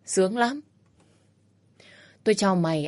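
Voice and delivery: usual speaking voice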